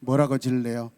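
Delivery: ordinary talk, nothing marked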